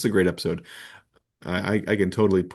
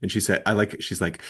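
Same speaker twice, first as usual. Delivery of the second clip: usual speaking voice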